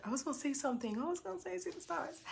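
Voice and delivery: teary murmur. Real, not acted. Emotional, losing her train of thought.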